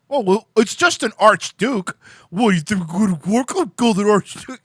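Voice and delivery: high, loud, dopey mocking voice; sneering parody of a naive politician; mock clueless leader. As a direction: play the oblivious fool brushing off war.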